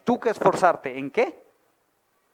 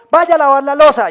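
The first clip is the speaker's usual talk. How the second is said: stern father order; 5 semitones higher, 9 dB louder than usual. Retold or imitated. Father voiced ordering son.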